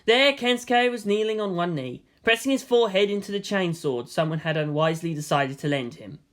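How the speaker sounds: ordinary delivery, nothing marked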